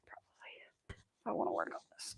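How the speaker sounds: barely voiced aside; whispering. Real, not acted. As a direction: whisper it to yourself. Muttered aside to self.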